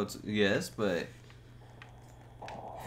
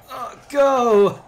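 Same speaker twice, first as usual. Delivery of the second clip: sudden high, loud push of effort; excited shout; 12 semitones higher, 12 dB louder than usual. Real, not acted. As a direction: yell it to force the tractor forward. Pushing stuck tractor in game.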